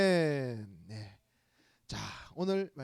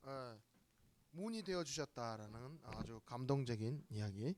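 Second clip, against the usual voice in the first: ordinary talk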